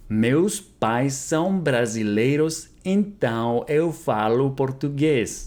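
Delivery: slow, careful, clearly spaced words; slow model repeat. Shown as a demonstration. Teacher repeating Portuguese for students, slow and careful. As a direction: say it slowly for learners to copy. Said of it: "slow repeat of model".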